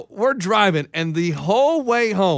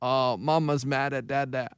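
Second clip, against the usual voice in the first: low, soft, slow mumble; drunk sulky mumble; 5 semitones lower, 5 dB quieter than usual. Retold or imitated. Ray sulking at his wife in the car, soft drunken mumble. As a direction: sulk it under your breath like a drunk.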